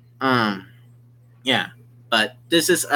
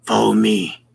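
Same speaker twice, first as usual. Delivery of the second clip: Ivanovich's voice; 8 semitones lower than usual. Acted. Ivanovich guiding the player to the food, low and grave. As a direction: drop the voice low for the stern guide. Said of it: "Ivanovich shows up and says".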